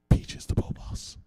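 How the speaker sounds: breathy game-host whisper. Pretend. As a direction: whisper the clue dramatically. Host whispers the next word.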